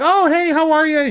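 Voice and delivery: high sing-song friendly impression; chirpy greeting. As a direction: imitate her cheery rapid catch-up greeting. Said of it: "she's like".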